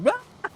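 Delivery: a very high, loud shriek; imitated panicked scream. Stepmom seeing the tree drop, shrill imitated scream. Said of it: "she just has that".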